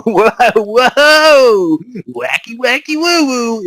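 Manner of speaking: high, silly cartoon voice; wacky goofy voice. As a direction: play it goofy and zany. Mocking goofy game tone shift.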